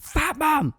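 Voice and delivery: very high, comic mock yell; high-pitched imitated shout; retold yelling. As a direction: holler it out for the street to hear. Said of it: "I'm just yelling Fat Mum".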